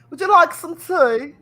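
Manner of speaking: playful mock British accent. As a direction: offer tea like a hammed-up Brit. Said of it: "Let me hear that British accent".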